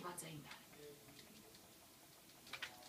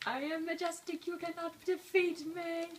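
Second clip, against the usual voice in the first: high, boastful villain voice; a hint of a gloating game boss; booming echoing villain monologue; 10 semitones higher than usual.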